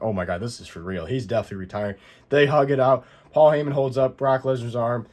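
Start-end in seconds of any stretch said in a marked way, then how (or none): 0.0-2.0 stunned, softer. Retold or imitated. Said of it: "I'm like".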